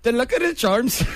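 high-pitched, badly faked Irish; bad Irish accent. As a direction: squeak out a botched leprechaun catchphrase. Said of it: "do you wanna hear mine again".

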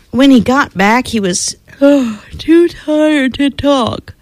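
ordinary delivery

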